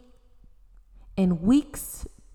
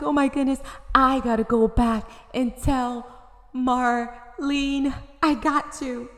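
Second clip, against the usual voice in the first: higher, louder, eager rush; astonished; 5 semitones higher, 5 dB louder than usual. Acted. Astonished girl eager to tell Marlene. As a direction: burst out in amazed excitement. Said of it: "She was astonished".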